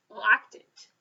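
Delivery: plain reading voice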